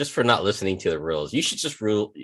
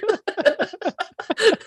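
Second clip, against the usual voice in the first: high, wheezy triumphant laugh; corny dad laugh. Real, not acted. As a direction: cackle at your own pun. His joke finally lands.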